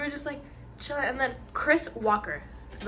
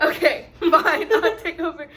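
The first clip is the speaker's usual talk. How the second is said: laughing; 4 semitones higher, 6 dB louder than usual. Real, not acted. Giving in while laughing.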